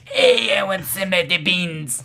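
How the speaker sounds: loud, high ghoul whine. Acted. Ghoul voice on request.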